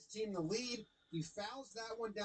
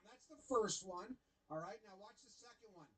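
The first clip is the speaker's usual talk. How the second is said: usual speaking voice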